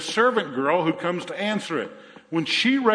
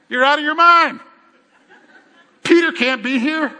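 much higher, loud exasperated outburst; disbelieving crowd; 9 semitones higher, 8 dB louder than usual. Acted. Prayer meeting telling the servant girl she's mad, loud incredulous outburst. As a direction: shout her down as crazy.

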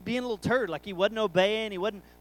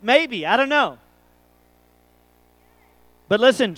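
ordinary talk

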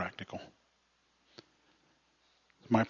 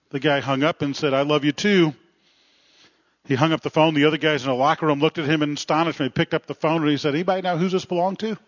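louder, higher, lively storytelling delivery; joke storytelling; 4 semitones higher, 5 dB louder than usual. Acted. Preacher tells congregation about men in a locker room, animated storytelling. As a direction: project it like a well-rehearsed funny anecdote.

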